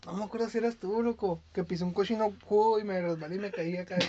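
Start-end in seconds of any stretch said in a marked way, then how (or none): none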